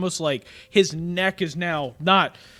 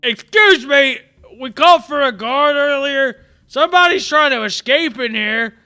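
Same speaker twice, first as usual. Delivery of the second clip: loud, higher call toward the door; calling to the guard; 7 semitones higher, 9 dB louder than usual. Acted. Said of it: "he turns his head toward the door and he goes".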